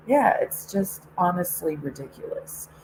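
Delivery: usual speaking voice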